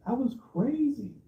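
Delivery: high, slow disbelief; incredulous. Real, not acted. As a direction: stretch it out in disbelief. Reacting to comment, high and slow.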